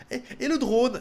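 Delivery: high-pitched imitation of nagging; mock pestering. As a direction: pester him with an insistent question. Repeats the shop's pestering question.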